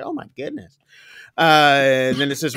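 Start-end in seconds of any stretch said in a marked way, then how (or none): 0.0-0.8 much higher, softer, breathless shock; shocked operator. Retold or imitated. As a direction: hush it out in disbelief. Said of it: "she's like".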